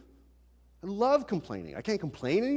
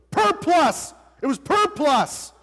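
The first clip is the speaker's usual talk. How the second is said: high, loud hammed-up yell; mock yell; 9 semitones higher, 7 dB louder, slower than usual. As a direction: yell the shampoo name like a crazed teen. Yelling at mom in story.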